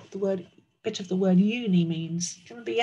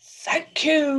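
loud, raised lion voice; roaring lion; 7 semitones higher, 6 dB louder than usual. Acted. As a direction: roar out your thanks. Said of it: "roared the lion".

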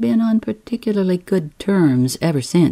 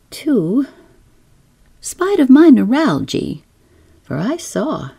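usual speaking voice

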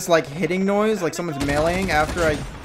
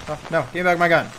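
fast panicked; much faster than usual. Real, not acted.

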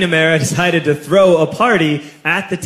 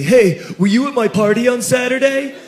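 higher, lightly voiced teen boy; mock classmate; 7 semitones higher than usual. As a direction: ask it a bit whiny and suspicious. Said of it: "he says to me".

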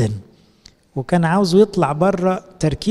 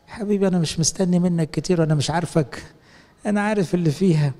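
ordinary talk, nothing marked

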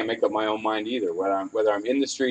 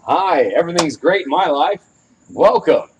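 loud, higher fake-cheerful greeting; fake happy host voice; 6 semitones higher, 8 dB louder than usual. Shown as a demonstration. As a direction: put on the everything's-great facade. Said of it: "you know, that voice".

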